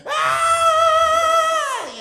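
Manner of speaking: very high, loud, sustained shriek; startled scream. Acted. Brother scared by sister sneaking up, piercing scream. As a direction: scream as if caught red-handed. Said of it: "That's how loud I screamed".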